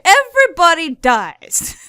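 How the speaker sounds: loud, high, slow proclamation; hammed-up announcement; mock dramatic yell. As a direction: draw out the spoiler at full volume. Repeats spoiler as a joke.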